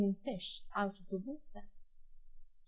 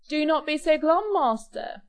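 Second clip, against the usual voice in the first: snooty bored cat; 6 semitones higher, 8 dB louder than usual. Acted. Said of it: "the cat said".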